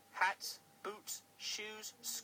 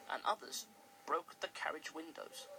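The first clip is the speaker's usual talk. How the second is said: ordinary delivery, nothing marked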